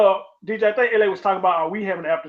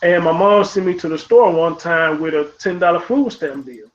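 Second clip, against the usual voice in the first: ordinary talk, nothing marked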